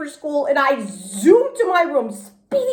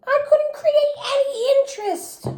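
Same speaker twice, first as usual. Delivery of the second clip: ordinary delivery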